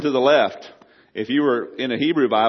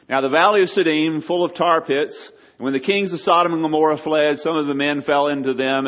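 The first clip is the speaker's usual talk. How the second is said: higher and louder, read out as scripture; 5 semitones higher, 3 dB louder than usual. Acted. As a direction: declaim the verse to the congregation. Reading an Old Testament verse aloud.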